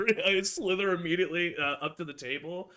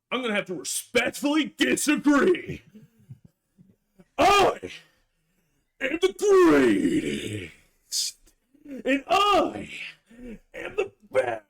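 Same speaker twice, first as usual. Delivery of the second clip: louder, higher, slow proclamation; wrestler-style challenge; 5 semitones higher, 4 dB louder, slower than usual. Acted. Rogue challenging Helena to a smackdown, loud. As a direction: boom it out like a pro wrestler promo.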